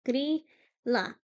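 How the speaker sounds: Grýla as GREE-la, rolled r, falling tune; Grýla, careful native say-it; correct Icelandic. Shown as a demonstration. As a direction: model Grýla for viewers to copy. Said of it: "That's how you say it".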